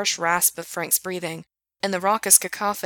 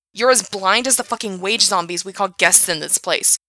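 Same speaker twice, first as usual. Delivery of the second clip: angry rant; 2 semitones higher, 6 dB louder than usual. Acted. Frank ranting at Gerard.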